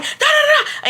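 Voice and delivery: high, taunting nonsense syllables; mocking babble. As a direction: talk over him like a child. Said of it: "I'm like".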